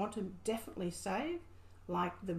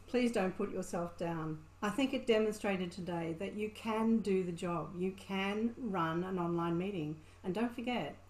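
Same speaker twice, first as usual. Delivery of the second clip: ordinary talk, nothing marked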